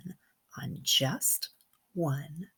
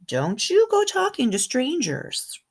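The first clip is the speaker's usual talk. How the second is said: higher, louder scolding mother voice; stern mom warning; 9 semitones higher, 5 dB louder than usual. Acted. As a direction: warn the child like a firm mom. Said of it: "But my mom always says".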